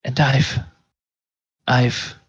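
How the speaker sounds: a hint of horrified clerk staring at a crumpled page; shocked dismay. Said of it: "she seems almost shocked".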